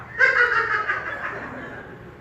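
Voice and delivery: high breathy mock giggle. Mom giggling at a horror film, mock high giggle. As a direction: cackle like a delighted mom.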